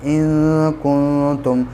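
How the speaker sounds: slow recitation of इन कुंतुम, the न hidden in the nose; Quranic recitation. Shown as a demonstration. Teacher demonstrating ikhfa to students, slow nasal recitation. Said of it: "यहाँ पे इख्फ़ा होगा".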